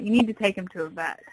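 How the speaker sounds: normal talking voice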